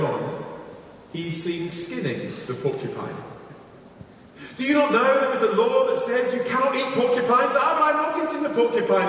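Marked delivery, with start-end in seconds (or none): 4.6-7.5 high-pitched storyteller's reproach; mock admonishing. Villager warning a porcupine hunter, mock admonishing. Repeated warning acted in fable. 7.5-9.1 high-pitched, hammed-up excuse; mock protesting. Hunter excusing himself to his accuser, mock protesting.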